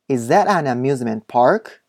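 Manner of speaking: slow "is that an amusement park?" rising at the end; rising question. Shown as a demonstration. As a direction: lift the pitch on "park" to ask. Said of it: "疑問文は最後を上げるように発音したり". (「is that an amusement park?」の最後を上げて)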